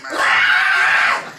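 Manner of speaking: huge, loud mass-fan shriek; crowd screaming. Retold or imitated. Comic imitating the women when Elvis turns his head, huge scream. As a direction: scream like thousands of swooning fans. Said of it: "They start screaming".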